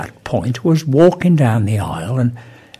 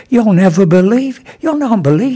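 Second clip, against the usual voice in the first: high-pitched, loud flustered blurting; agitated disbelief; 9 semitones higher, 4 dB louder than usual. Acted. Enoch tells vicar about the angel, high and agitated. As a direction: blurt it out, worked up.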